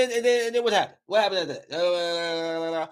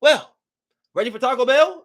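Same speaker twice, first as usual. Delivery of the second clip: high, cheerful mock nonchalance; mock breezy; 10 semitones higher, 5 dB louder than usual. Character right after a death, breezily suggesting Taco Bell. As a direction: toss it off like nothing happened.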